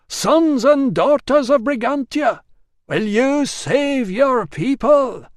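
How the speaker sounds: high, loud, slow rallying cry; storyteller rallying his audience with a shout; rousing shout. A narrator's reading. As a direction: whip the crowd into a frenzy. Storyteller rouses the crowd.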